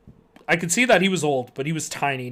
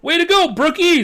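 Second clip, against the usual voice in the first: loud, high, happy cheer; happy yell; 13 semitones higher, 9 dB louder than usual. A narrator's reading. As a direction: cheer him on loudly. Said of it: "Zeke yelled happily".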